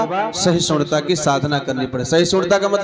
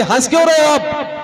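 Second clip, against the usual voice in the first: high-pitched, acted-out demand; mock indignant question, pitched way up; indignant wife; 9 semitones higher than usual. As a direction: challenge him as a baffled, cross wife. Acted wife demands why he laughs.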